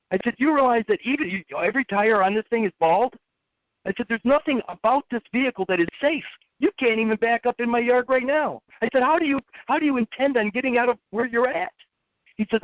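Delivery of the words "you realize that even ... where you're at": higher, incredulous scolding; lightly acted exasperation; mock lecture. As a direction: grill him about the bald tires. Lecturing kid about unsafe van.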